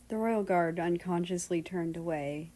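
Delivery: usual speaking voice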